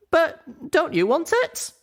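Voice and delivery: high, slow surprised ask; can't believe the offer; surprised question. Acted. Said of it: "I asked, surprised".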